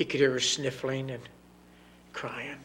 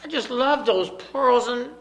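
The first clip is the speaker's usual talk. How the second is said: put-on torn little girl; 11 semitones higher, 9 dB louder than usual. Little girl refuses to give daddy her pearls, high. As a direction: plead while torn. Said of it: "little girl pleads".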